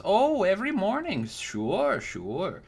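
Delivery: put-on high, sweet old woman voice, fawning; a doting old crone cooing over a young knight; sugary old lady flattering the customer; old crone baker.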